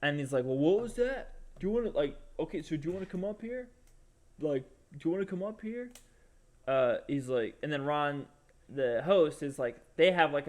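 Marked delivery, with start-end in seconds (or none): 0.4-5.9 imitated challenge, pitched up; challenging comedian. Comedian daring the guy to come up, mock challenge. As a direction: mimic a comic calling out a heckler.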